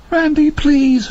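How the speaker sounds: very high, slow trembling plea; terrified plea. A narrator's reading. Laverne begging Randy in terror, very high slow plea. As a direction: beg him, terrified.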